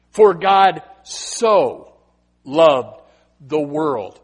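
very slow, each word weighed, loud; slow emphatic scripture. Acted. Preacher declaring the verse to the congregation, very slow and weighty. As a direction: lay out "For God so loved the world" word by word. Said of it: "slow emphatic scripture".

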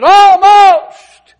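Almost cried out very high and loud; shouted Almost. Acted. Preacher crying out King Agrippa's Almost, very high and loud. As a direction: fling the word Almost out.

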